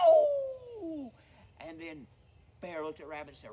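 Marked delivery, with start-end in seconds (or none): 0.1-1.1 high, loud howl of pain; long piercing "ow"; pained yelp. Acted. Rabbit's tail is bitten off, pained yelp. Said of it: "And Rabbit yelped".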